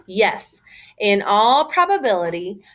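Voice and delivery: plain reading voice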